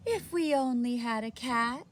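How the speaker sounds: put-on sighing old woman. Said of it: "sighed the very old woman".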